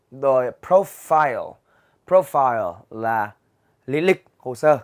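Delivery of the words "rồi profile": normal talking voice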